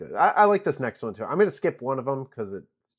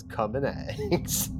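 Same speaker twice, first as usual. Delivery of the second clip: slow tagline read; much slower than usual. Pretend. Poster tagline read slowly.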